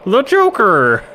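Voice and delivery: high-pitched comic-villain voice; Joker. Acted. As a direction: announce it grandly, high and loud. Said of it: "That's my Joker voice".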